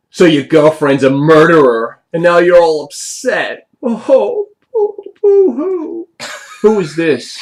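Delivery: high, loud, drawn-out mocking; childish taunting bully. Thug jeering at a halfling about his girlfriend, childish mockery. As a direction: taunt like an overgrown five-year-old. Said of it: "who talks like that? What is he, five?".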